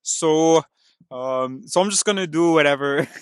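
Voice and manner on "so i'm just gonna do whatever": loud, higher, breaking into laughter; laughing while talking. Real, not acted. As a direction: give up and laugh through the words. Laughing alone at own accents.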